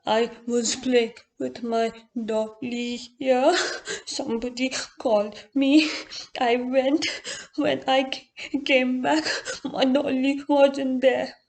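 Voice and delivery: put-on slow, tearful child's voice, on the verge of sobbing; a five-year-old choking back tears over her lost doll; tearful little girl. A narrator's reading. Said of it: "fighting through her tears".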